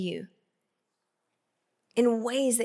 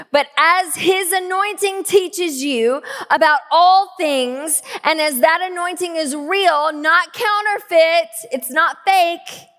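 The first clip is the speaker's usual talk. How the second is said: loud, raised preaching voice; high, ringing pulpit delivery; emphatic scripture reading; 7 semitones higher, 8 dB louder than usual. Real, not acted. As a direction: proclaim the verse with rising force. Reads scripture with force.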